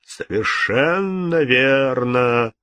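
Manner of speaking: very slow, eerie drawl; ghostly voice. A narrator's reading. Ghost agreeing with the king, sepulchral. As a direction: stretch it out like a spectre. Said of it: "отвечал голос".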